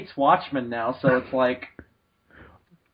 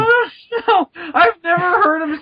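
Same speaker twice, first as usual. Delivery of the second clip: mock crying; 10 semitones higher than usual.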